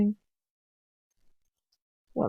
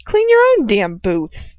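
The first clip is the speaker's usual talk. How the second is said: loud, defiant snap; defiant retort; 9 dB louder than usual. A narrator's reading. Girl defiantly refuses to clean his boots. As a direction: throw the refusal at him.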